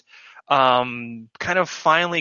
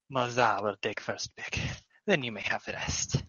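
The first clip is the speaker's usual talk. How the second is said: a small creature murmuring its thanks; kobold voice; 9 dB quieter than usual. Acted. Morzar claims first pick.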